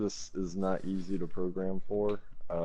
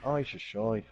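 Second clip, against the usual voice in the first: Irish accent. Shown as a demonstration. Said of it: "you got it".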